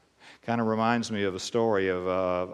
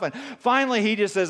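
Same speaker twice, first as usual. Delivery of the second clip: normal talking voice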